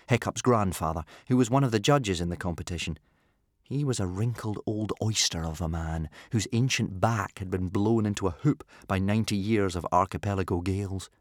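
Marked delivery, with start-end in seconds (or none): none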